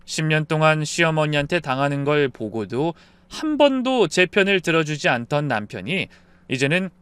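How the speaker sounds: ordinary delivery, nothing marked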